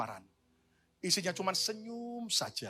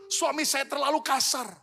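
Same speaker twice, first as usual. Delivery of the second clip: pleading wife; 9 semitones higher, 4 dB louder than usual. Pretend. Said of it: "Saudara mungkin berkata".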